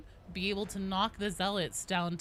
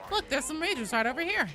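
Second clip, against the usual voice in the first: high, raised-voice callout; calling out; 10 semitones higher, 5 dB louder than usual. Real, not acted. Player pointing teammates to mages, calling out. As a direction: call out enemies to the team, pitched up. Calling out enemies mid-game.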